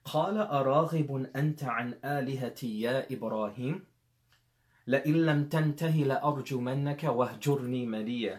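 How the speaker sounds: rapid melodic Arabic recitation; Quran recitation. Acted. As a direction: recite the verse in quick chant. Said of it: "that's when the father now says".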